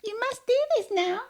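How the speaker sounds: squeaky high voice with no authority in it; high, non-commanding voice. Shown as a demonstration. Asked to say it without command, friend squeaks it up high. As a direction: pitch it way up so it sounds like no command at all. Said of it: "in a voice that does not convey command".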